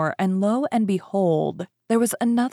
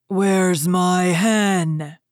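loud, very slow roar; ogre's shout; 5 dB louder, much slower than usual. A narrator's reading. Ogre wakes to find hen gone, shouting. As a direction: bellow each word as a furious giant. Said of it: "he shouted".

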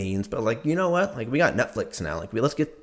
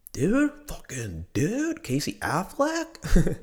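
amazed; 9 semitones higher, much slower than usual. Real, not acted. Amazed at the movie cast.

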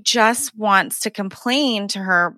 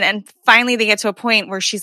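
ordinary talk

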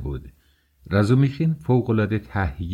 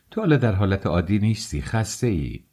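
ordinary delivery

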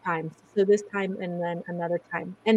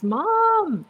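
calling out; 11 semitones higher, 4 dB louder than usual. Retold or imitated. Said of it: "I just hear this".

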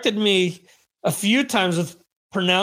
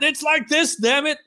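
much higher, hammed-up exasperated "damn it"; mock frustrated Jeremy; 9 semitones higher than usual. Host voicing co-host fuming at the mispronunciations, hammed up. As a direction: play Jeremy shaking his fist in mock frustration. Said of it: "Jeremy's throwing his fist in the air".

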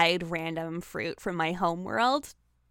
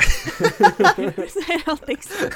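laughing; 8 semitones higher, 10 dB louder, much slower than usual. Real, not acted. Giggling at potato joke.

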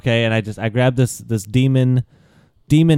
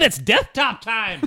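slightly raised, stretched-out mock call; slow, higher-pitched quoted cry; quoting a friend's yell; 4 semitones higher, slower than usual. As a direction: lightly imitate a friend's drawn-out holler. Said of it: "He said".

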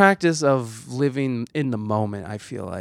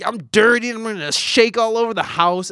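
dog's eager demand, pitched higher; playful dog voice; 7 semitones higher than usual. Retold or imitated. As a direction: voice the nosy dog, a little higher. Voicing a pushy dog.

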